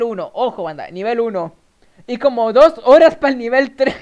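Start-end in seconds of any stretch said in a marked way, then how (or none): 2.1-3.9 much louder, astonished exclamation; loud disbelief. Real, not acted. Streamer telling viewers the longer time, loudly incredulous. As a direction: build on the shock, nearly shouting.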